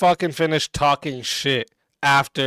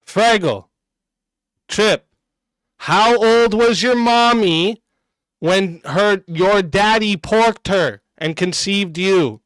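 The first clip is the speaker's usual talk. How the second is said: mocking taunt; 5 semitones higher, 4 dB louder, slower than usual. Real, not acted. Taunting a chatter on stream.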